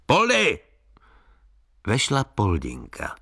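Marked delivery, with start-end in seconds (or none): 0.1-0.6 high-pitched call down a corridor. A narrator's reading. Factory owner summons the maid from the corridor, calling out. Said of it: "zavolal do chodby".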